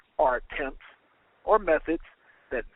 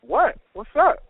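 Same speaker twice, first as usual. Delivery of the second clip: higher, louder questioning "what?"; raised, punchy comeback to being hailed; startled reply; 7 semitones higher, 6 dB louder than usual. A narrator's reading. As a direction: answer a sudden call, caught off guard. Tired worker answers the excited call.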